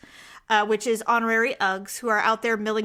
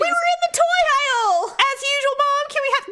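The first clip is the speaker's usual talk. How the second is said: high-pitched imitated kid; put-on child's excuse; child to mother; 12 semitones higher than usual. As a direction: plead upward to Mom like a kid. Voices children answering angry mother.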